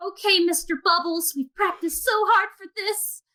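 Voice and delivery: put-on high-pitched kid talking to a pet; little kid. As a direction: pipe up like an eager little child. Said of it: "there's a kid with a giant tortoise".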